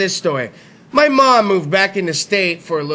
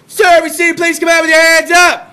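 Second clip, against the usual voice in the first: higher, louder police megaphone call; police yell; 7 semitones higher, 5 dB louder than usual. Retold or imitated. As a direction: yell it at the window like a cop. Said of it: "Yell again".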